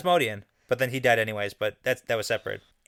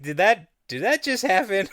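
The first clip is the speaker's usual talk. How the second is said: high-pitched, stammering disbelief; acted shock, pitched up high; stunned disbelief; 7 semitones higher than usual. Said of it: "Min is still trying to process".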